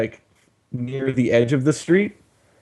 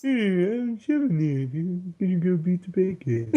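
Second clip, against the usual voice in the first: a goofy noble knight introducing himself; Shovel Knight voice; 4 semitones higher, 6 dB quieter than usual. Acted. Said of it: "What was the Shovel Knight voice?".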